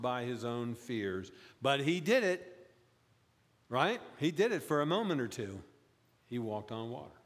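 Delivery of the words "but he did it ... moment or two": did it pushed higher and louder; stress on did. Real, not acted. Pastor insisting Peter did walk, stressing did. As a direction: lean hard on did. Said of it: "stresses did".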